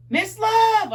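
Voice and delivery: calling out loud and high across a lobby; hollering. Acted. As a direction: holler her name across the crowd. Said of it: "I hollered".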